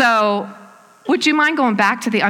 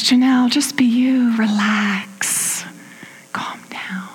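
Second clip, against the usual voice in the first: breathy, tender inner-voice whisper. Retold or imitated. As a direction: whisper it like a gentle voice soothing you. Inner voice of God comforting her.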